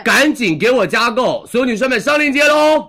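excited shout. Real, not acted. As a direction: call out to all the buyers. Shouting to add to cart. (高亢大喊)

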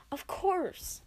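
exclaiming. Acted. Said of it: "I exclaimed".

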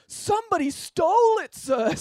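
put-on high, loud boy voice pleading; anxious boy. A narrator's reading. Pupil pleads to his teacher, high and loud. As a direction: blurt it out in a squeaky boy voice. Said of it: "said Parker".

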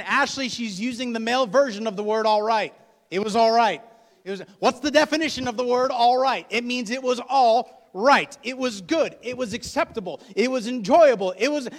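loud, high, insistent man defending a word; exasperated explaining. Acted. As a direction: spell out the definition at full volume. Exasperated man explaining over a panic.

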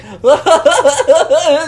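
loud, high put-on laughing fit; exaggerated high cackle; forced giggle. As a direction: imitate a performer laughing under duress. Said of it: "Do the McGiggles".

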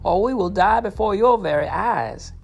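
high, slow, desperate pleading voice; a high, desperate cry for food, slowed down. Acted. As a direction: beg for food as if starving. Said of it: "they cried".

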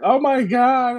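high, drawn-out dismay; imitated horror. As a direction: mimic her dismay in a high voice. Voices Abby's reaction to horse scene.

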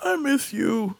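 high, needy imitation; high-pitched mock sweetness; old driver phoning. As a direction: play a pining old man on a call. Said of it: "every time they'll call me".

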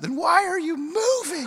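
put-on high, loud child's shout; kid's scream. A neighbor's kid yells at the moving family, loud. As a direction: shriek it like a little kid. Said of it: "one of their kids screams out".

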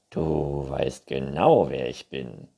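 low, drawn-out unicorn voice; Neinhorn. A narrator's reading. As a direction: stretch it out, knowingly. Unicorn figure, lower voice. (tiefe, gedehnte Einhornstimme)

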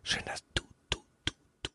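breathy unvoiced whisper imitation; ASMR whisper. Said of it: "sie macht immer so".

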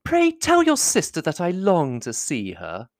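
high, slow society lady voice; high-pitched, drawn-out flattery; gushing flatterer. A narrator's reading. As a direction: coo admiringly over his letter. Miss Bingley pesters Darcy as he writes.

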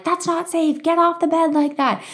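put-on high, sharp yell at a child; retold scream at daughter. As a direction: yell at her to get off the bed. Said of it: "I'm screaming at Veda upstairs".

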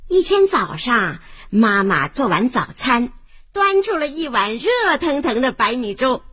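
ordinary delivery

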